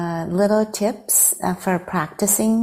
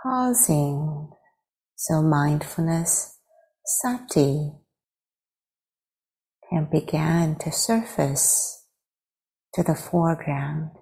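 guided meditation. Real, not acted. Teacher leading friends to arrive in the body, guided meditation. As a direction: guide them unhurriedly into stillness.

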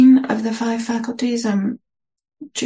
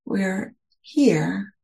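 guided meditation. Acted. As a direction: ease listeners into their posture, unhurried.

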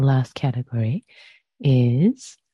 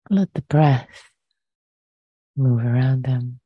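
guided meditation. Acted. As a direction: ease listeners gently into the body.

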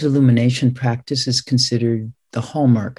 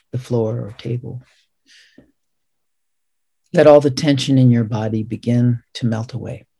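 guided meditation. Real, not acted. Zen teacher leading students in counting breaths, guided meditation. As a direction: guide them gently through each breath, leaving space.

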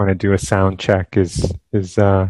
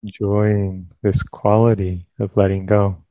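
calm, unhurried phrases with long pauses; guided meditation. Real, not acted. Teacher leading listeners into sitting meditation, calm guided meditation. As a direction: guide them gently into stillness, leaving space between phrases.

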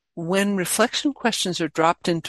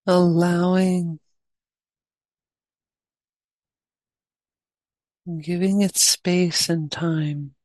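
guided meditation. Real, not acted. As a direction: guide them gently, leaving long pauses between phrases.

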